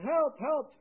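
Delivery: hammed-up high yell; mock yell for help. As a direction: cry out from the bottom of a hole. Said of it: "So he's yelling".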